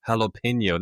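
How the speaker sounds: Jalapeno said "hah-lah-pain-yo"; pronounced with H. Retold or imitated. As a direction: start Jalapeno on an H sound. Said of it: "pronounced like an H".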